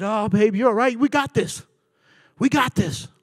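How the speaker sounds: reassuring, being strong. Retold or imitated. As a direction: keep it light and steady for her. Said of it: "I wanted to be strong for her".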